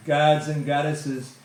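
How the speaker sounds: normal talking voice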